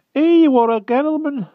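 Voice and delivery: put-on a frightened old hedgehog begging humbly; timid hedgehog voice. A narrator's reading. Hedgehog begging the Wart for mercy, high timid rustic voice. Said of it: "cried the hedgehog desperately".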